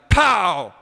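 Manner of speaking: a sudden loud shouted pow; loud comic impact pow. A narrator's reading. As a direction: slam it out like a blast. Narrator voices the pie slam.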